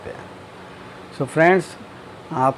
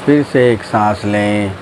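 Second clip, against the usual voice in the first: low, slow drawn-out meditation voice; guided meditation; 5 semitones lower, slower than usual. Acted. Teacher guiding friends through breathing and relaxing, guided meditation. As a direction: stretch key words slowly, sinking lower at each phrase end.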